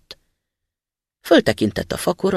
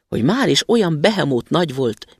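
ordinary delivery

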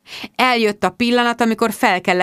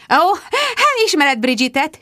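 chirping; 9 semitones higher, 3 dB louder than usual. A narrator's reading. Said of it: "csicsergett Rebecca".

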